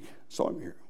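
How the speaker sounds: breathless whisper about the son; whispered confession. Acted. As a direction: hush it like a terrible secret. Soul in hell confiding.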